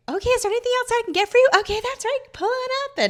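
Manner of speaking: super peppy. Retold or imitated. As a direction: rattle it off in a sugary sing-song. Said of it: "super peppy".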